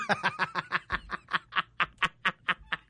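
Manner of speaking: long real laugh; real laughter. Real, not acted. Reading a viewer's message aloud, laughing. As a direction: burst out laughing.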